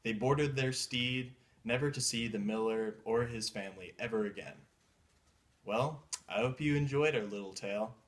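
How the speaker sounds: usual speaking voice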